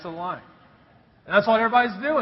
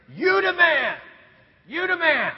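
high, raised fan's shout; crowd cheer; 8 semitones higher, 4 dB louder than usual. Retold or imitated. Gallery cheers the golfer after a great shot, shouting. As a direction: chant it like a whole gallery cheering. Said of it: "all of the gallery would start saying".

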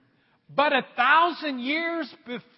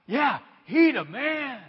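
slow, hyped-up street-talk approval; the elders admiring Eliab; impressed elders; 2 semitones higher, slower than usual. Acted. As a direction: drag it out admiringly. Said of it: "they're like".

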